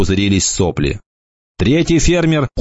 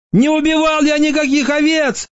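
very high, squeaky tearful wail; whiny, high-pitched sobbing character voice; crying. A narrator's reading. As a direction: wail a desperate denial in a tiny high voice. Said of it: "снова заплакал червь".

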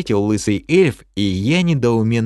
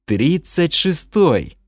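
slow, stretched, higher surprise; surprised exclaim; 6 semitones higher, much slower than usual. A narrator's reading. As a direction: savour the number in disbelief. Narrator echoes level in surprise. (удивлённо и медленно)

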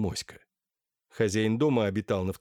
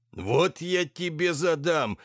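angry threat; 6 semitones higher, 3 dB louder, slower than usual. Acted. Lackey threatening the dog.

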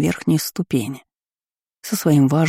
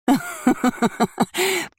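gloating laugh; 13 semitones higher than usual. Acted. Baroness gloats over husband.

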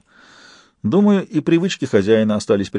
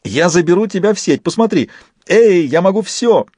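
excited; 8 semitones higher than usual. A narrator's reading. Fist overjoyed, boasting.

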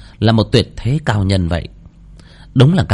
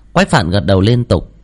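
ordinary delivery

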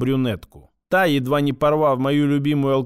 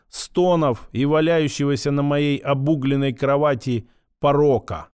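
plain reading voice

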